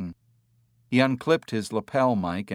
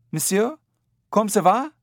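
high, raised call in French; yelled French; 11 semitones higher, 4 dB louder than usual. A narrator's reading. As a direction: call out a French greeting up to the trees. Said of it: "the heavy-set man yelled".

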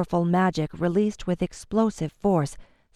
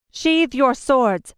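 slow, higher, louder command; commanding compulsion; 6 semitones higher, 5 dB louder, slower than usual. A narrator's reading. Queen compelling the enraged men, slow commanding voice. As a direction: lay the order on them with power. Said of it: "she commanded, infusing her voice with compulsion".

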